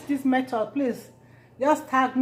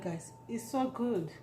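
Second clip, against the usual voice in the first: normal talking voice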